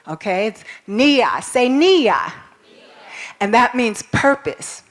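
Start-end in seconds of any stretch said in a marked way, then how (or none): 0.5-2.3 nia said NEE-ah, clearly; Swahili word. Shown as a demonstration. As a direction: model nia for the class. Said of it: "Some people may be named Nia".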